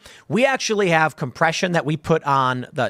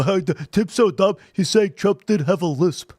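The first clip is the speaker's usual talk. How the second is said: mocking critic. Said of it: "the left is gonna go".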